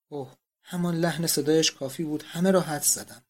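ordinary delivery

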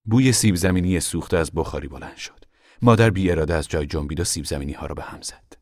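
ordinary delivery, nothing marked

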